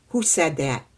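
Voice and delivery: usual speaking voice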